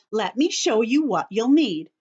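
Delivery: usual speaking voice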